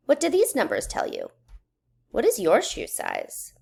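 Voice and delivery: usual speaking voice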